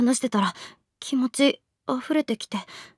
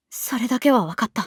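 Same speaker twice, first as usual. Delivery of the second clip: ordinary talk, nothing marked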